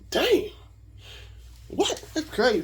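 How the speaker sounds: high-pitched retold astonishment; amazed. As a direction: let the pitch jump in happy disbelief. Retold amazement at unsecured card offer.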